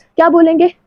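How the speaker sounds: ordinary talk, nothing marked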